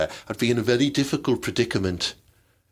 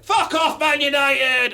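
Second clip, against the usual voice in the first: a hammed-up pundit shouting at his rivals; mock furious shout; 13 semitones higher, slower than usual. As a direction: yell it at the telly like a bitter fan. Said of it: "always like, go".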